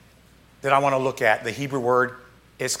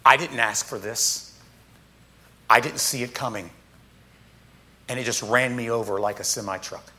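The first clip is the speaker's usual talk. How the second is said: low, quiet, weary-sounding quote; subdued lament; 6 semitones lower, 7 dB quieter than usual. Retold or imitated. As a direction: voice the stunned sufferer quietly, low. Psalmist lamenting an unexpected blow.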